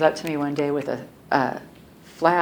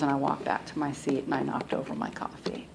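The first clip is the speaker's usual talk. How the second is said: normal talking voice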